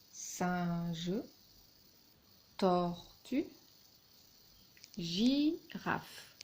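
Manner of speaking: "singe", "tortue", "girafe" each split in two; separated syllables. Shown as a demonstration. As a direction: stretch the first half of "singe", "tortue", "girafe". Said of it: "pour séparer les syllabes".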